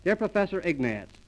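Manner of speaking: ordinary delivery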